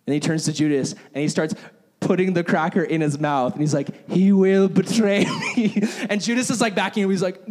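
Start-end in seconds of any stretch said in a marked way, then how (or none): 4.1-5.8 slow, louder line with a sinister laugh; creepy laughing Jesus. Retold or imitated. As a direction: ham up the creepy film Jesus, chuckling. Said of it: "It is the creepiest".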